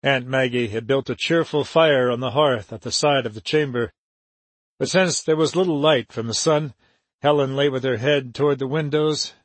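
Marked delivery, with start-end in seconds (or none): none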